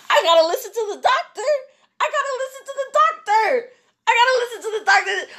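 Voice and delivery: high-pitched frantic insistence; acted panic. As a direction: squeal it in comic desperation. Acts out panicked resignation.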